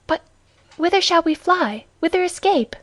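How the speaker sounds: worried woman, lightly acted; alarmed questioning. A narrator's reading. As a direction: ask with rising urgency and alarm. Frightened woman asks where to flee.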